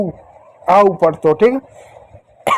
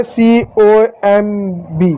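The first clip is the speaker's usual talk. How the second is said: spelling out; much slower than usual. Shown as a demonstration. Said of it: "इसको क्या पढ़ेंगे".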